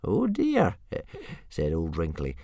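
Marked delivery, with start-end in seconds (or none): 0.0-0.8 put-on high old man, barely holding in a laugh; oh dear, trying not to laugh; amused old man. Old Wrinkly to Hiccup, high and amused. 0.8-1.3 breathless wheezy chuckle over a pipe. Acted. Old man laughing at Hiccup, soft wheezy chuckle. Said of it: "chuckling in a wheezy way".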